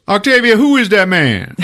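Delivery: loud, high hollered question; hammed-up indignant husband; acted alarmed husband. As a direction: holler to your wife about a stranger.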